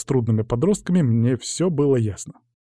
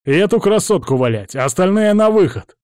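loud, raised commanding voice; forceful shout to take control; yelling; 5 semitones higher, 5 dB louder than usual. A narrator's reading. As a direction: bellow orders at a gang. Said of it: "заорал я".

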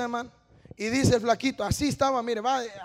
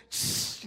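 hissed "shh" spray; gushing tears noise; 8 dB quieter than usual. Retold or imitated. Showing how his tears gushed, hissing spray sound. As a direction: imitate water squirting out. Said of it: "así me hacían los ojos".

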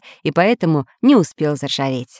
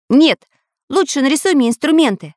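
eager cut-in; 6 semitones higher than usual. A narrator's reading. Samodelkin cuts in.

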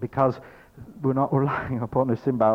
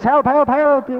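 high, loud repeated cries of help; three voices hollering from the hole; yelling for help; 13 semitones higher, 8 dB louder, slower than usual. Acted. Three people stuck in the hole yelling for help, loud. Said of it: "now there's three of us".

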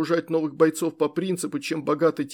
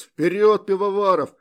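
loud, higher battle cry; rally him forward with a yell; shouting; 5 semitones higher, 6 dB louder than usual. A narrator's reading. Lieutenant rallying soldier in battle.

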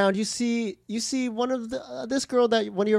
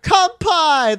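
cheering; 6 semitones higher, 7 dB louder than usual. Retold or imitated. Friends cheering a toast.

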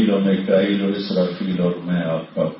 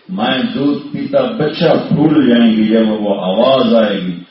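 ordinary talk, nothing marked

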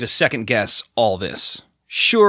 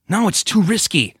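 protesting; 8 semitones higher, 3 dB louder than usual. A narrator's reading. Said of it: "Trent protested".

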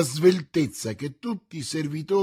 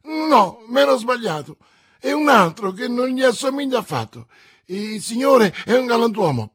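frantic backpedal; 10 semitones higher, 9 dB louder than usual. A narrator's reading. Bonacieux frantically takes it back.

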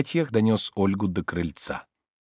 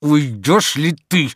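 slow, loud, raised pressure; through clenched teeth; 7 semitones higher, 6 dB louder, much slower than usual. A narrator's reading. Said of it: "сжав зубы, заговорил Обломов". (сквозь зубы, с нажимом)

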